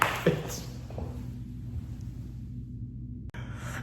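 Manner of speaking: soft breathy wheezing laugh; long voiceless wheeze of laughter. Real, not acted. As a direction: laugh yourself out of breath, quietly. Laughs at own story.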